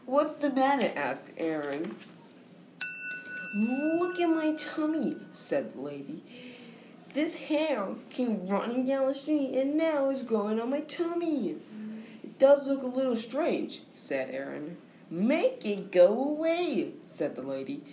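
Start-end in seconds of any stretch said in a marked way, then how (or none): 0.0-0.9 high, lightly voiced young boy; little boy voice. Aaron asks. 3.5-11.6 upset lady voice. Acted. Lady in the story. 12.2-13.8 high, lightly voiced young boy; little boy voice. Aaron replies. 15.1-16.9 high, slow distressed woman; upset lady voice. Acted. Lady pleads.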